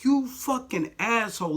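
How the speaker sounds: slow, high, disgusted insult; contemptuous inner thought. Retold or imitated. Thinking it at the manager who called it a prank, slow high disgust. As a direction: spit the insult out slowly in your head. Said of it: "that's what I'm thinking in my head".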